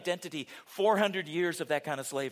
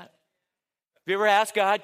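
ordinary talk

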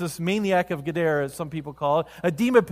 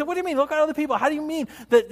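much higher, exasperated retort; irritated; 10 semitones higher, much faster than usual. Acted. Disciples to Jesus, irritated and much higher. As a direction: scoff at him in mock exasperation. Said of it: "the disciples are kinda irritated".

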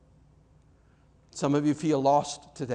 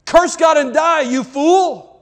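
furious shout, much higher and louder; raging wife; 10 semitones higher, 13 dB louder than usual. Retold or imitated. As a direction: hurl it at him in a rage. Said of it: "She's just in a rage".

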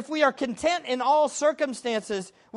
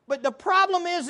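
ordinary talk, nothing marked

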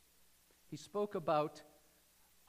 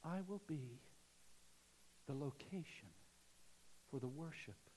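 hushed; 4 semitones lower, 11 dB quieter than usual. Acted. Speaking as Jesus, hushed.

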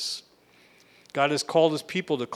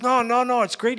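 excited; 10 semitones higher, 5 dB louder than usual. Retold or imitated. Friend gushing about jail.

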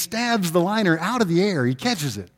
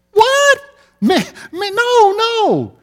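high, loud mock whine of a fouled player; hammed-up high protest, no no; outraged player protest; 9 dB louder, much slower than usual. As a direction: squeal like a player who swears he's innocent. Player protests foul call.

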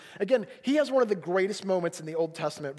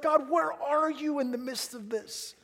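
raised pitch, slowed, pleading question; confused appeal to God; 7 semitones higher, slower than usual. Acted. Preacher voicing the psalmist questioning God, confused and pleading. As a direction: draw out a bewildered question to God. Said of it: "He's confused".

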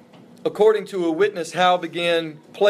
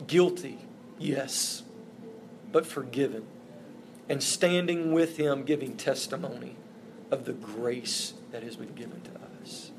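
softer, fading, holding back tears; choked up; 4 semitones lower, 7 dB quieter than usual. Real, not acted. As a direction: speak through rising tears. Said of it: "I'll be all right in just a minute".

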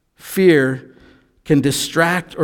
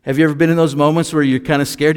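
normal talking voice

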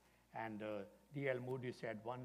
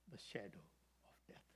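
hushed reveal; 5 semitones lower, 11 dB quieter than usual. Acted. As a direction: sink to a whisper on the last words. Pastor's quiet punchline to child.

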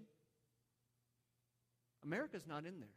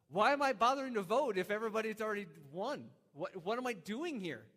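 louder, exasperated retold question; retold exasperation; 5 semitones higher, 9 dB louder than usual. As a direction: throw up your hands in disbelief at the pointless wait.

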